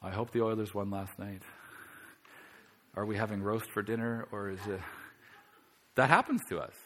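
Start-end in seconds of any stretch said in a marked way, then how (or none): 0.0-4.8 mock distracted prayer.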